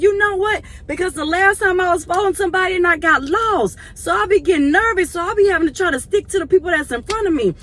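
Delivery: put-on high, loud nervous old lady; imitating the elderly lady; elderly lady explaining. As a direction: explain like a worried elderly driver. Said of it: "she said".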